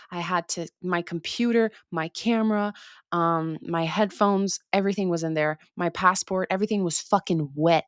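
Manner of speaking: normal talking voice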